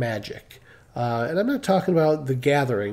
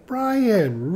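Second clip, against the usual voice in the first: higher, drawn-out retold yell; imitated loud call; 6 semitones higher than usual. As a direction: mimic someone calling a name down a hall. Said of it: "really loud".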